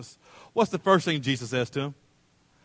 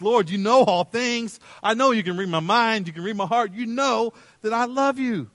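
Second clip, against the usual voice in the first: distressed; 6 semitones higher, 3 dB louder than usual. Acted. Peter answers Jesus the third time, distressed. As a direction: plead it as if desperate to be believed. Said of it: "you hear in Peter's voice, distressed".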